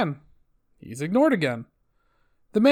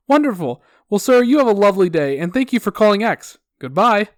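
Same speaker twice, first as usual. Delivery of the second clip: loud, upbeat customer service sign-off; sickeningly positive; 3 semitones higher, 6 dB louder than usual. Acted. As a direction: send the caller off with fake cheer. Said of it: "my sickeningly positive customer service attitude".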